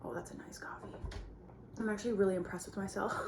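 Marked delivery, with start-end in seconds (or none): 0.0-0.9 hushed little aside; whispering. Real, not acted. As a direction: whisper it to yourself. Tasting coffee, quiet aside.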